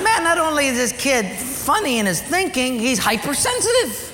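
high, laughing scoff; laughing dismissively. Retold or imitated. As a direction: chuckle at the boy's tears. Father laughing at son.

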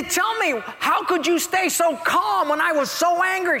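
high-pitched, insistent questioning; baffled pleading husband. Acted. Dying husband asks wife her secret, baffled pleading. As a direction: plead for the answer, voice way up.

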